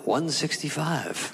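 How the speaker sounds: drawn-out weight readout; measured, deliberate pronouncement; slow announced verdict. Acted. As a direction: read the number out slowly and deliberately. Doctor reads out true weight.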